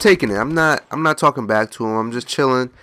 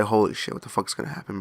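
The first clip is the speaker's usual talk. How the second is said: dreading aside; 2 semitones lower, 8 dB quieter than usual. Retold or imitated. Customer about to talk to stranger's wife, muttered dread. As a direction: trail down into a muttered worry. Inner dread before phone call.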